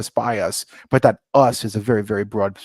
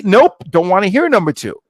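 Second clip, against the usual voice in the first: loud, higher-pitched shutting down; emphatic refusal; 8 semitones higher, 6 dB louder than usual. Retold or imitated. Refusing his buddy's other name choices, emphatic. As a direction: cut the buddy off, loud and high.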